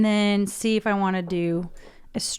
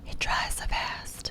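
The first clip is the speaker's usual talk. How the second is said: soft whispered aside, slower; 7 dB quieter, slower than usual. Real, not acted. Vlogger to viewers while painting, whispering. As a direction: whisper it while concentrating on the brush. Painting nails, talking to camera.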